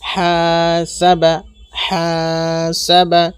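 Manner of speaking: হা held long, সাবা short; long first syllable. Shown as a demonstration. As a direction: draw out হা, then quick সাবা. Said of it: "টান হবে এখানে".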